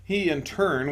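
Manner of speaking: ordinary delivery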